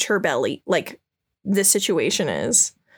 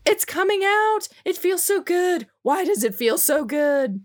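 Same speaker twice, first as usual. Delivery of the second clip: high moaning priestess; 10 semitones higher than usual. Retold or imitated. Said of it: "And she's just like".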